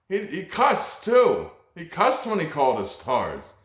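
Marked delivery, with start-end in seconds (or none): none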